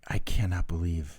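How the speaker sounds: tearful whisper. Retold or imitated. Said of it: "I started crying, going".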